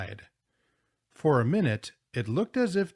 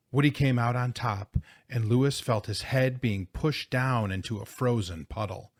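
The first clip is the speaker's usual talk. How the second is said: plain reading voice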